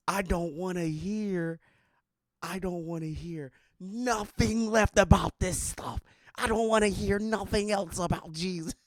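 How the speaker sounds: high, loud mocking angry rant. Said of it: "this dude sounded like he was pissed".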